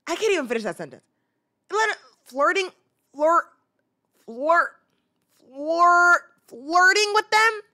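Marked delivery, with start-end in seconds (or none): 1.7-7.6 loud, high, sputtering disbelief; incredulous. Real, not acted. Vlogger raging about an adult flirting, loud. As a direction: choke on the word in outrage. Disgusted rant, stuttering.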